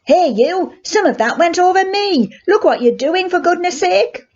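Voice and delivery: put-on boy soaked with water, shouting angrily at the culprit; indignant shout. A narrator's reading. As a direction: yell at the clumsy boy. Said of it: "He shouted at the boy".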